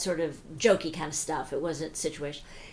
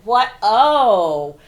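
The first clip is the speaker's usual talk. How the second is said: sudden loud startled "Oh!"; startled cry; 4 semitones higher, 14 dB louder than usual. Retold or imitated. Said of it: "I went".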